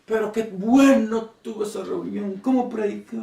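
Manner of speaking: imitating a dazzled churchgoer after a meeting; gushing churchgoer. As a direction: coo over the sermon, lightly mocking. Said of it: "que dicen".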